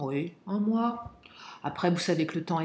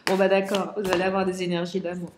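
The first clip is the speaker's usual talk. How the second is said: ordinary talk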